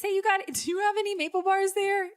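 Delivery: high-pitched sweet asking voice; polite phone inquiry. Retold or imitated. Acted phone request to girl.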